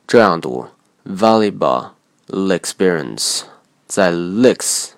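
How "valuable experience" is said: slow valuable experience with a deliberate pause before the stressed "pe" of experience; exaggerated pause. Shown as a demonstration. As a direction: run valuable into experience, stop briefly, then release a clear "pe". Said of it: "故意夸张点儿加进停顿，这样读".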